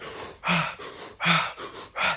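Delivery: a long unvoiced breath out, shown to the listener; breathy sigh demo. Shown as a demonstration. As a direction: push the air out in a long voiceless sigh. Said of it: "Sometimes I'm like".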